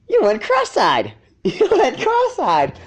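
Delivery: high, slow, laughing through the words; guffawing. Acted. Filly laughing at a cross-eyed dragon, guffawing. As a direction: cackle it out at him, high and slow. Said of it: "guffawed Sweetie Belle".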